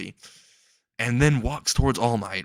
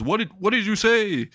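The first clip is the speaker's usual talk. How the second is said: mock indignation; 10 semitones higher than usual. All Might challenges a mocker.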